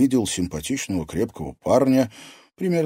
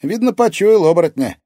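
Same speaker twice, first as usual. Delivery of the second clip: put-on high, loud teenage boy; bright, high boy's retort; boyish character voice; 12 semitones higher, 10 dB louder than usual. A narrator's reading. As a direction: say it proudly as a ringing-voiced lad.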